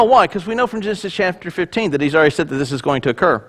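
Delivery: usual speaking voice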